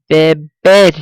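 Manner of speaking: beber, punching the second BER; final-syllable stress. Shown as a demonstration. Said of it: "stress will fall on the last syllable".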